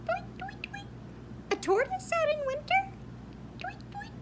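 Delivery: very high twittering bird voice; very high fast bird chatter; cheeping bird. Acted. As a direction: chirp it like a tiny bird. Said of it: "cheeped the bird".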